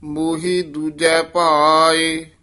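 measured, sustained verse recital; scripture recitation. Acted. Teacher reciting scripture for students, measured recitation. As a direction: recite sacred verse with drawn-out line endings.

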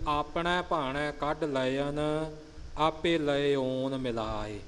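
slow recital, keeping ਲਇਨ and ਲਇਓਨ distinct; slow, deliberate verse reading separating ਲਇਨ from ਲਇਓਨ; careful pronunciation demo. Shown as a demonstration. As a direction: draw out ਲਇਨ with an "a" and ਲਇਓਨ with an open "o". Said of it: "ਇਉਂ ਇਹਨਾਂ ਦੇ ਉਚਾਰਨ ਦਾ ਖਿਆਲ ਰੱਖਣਾ".

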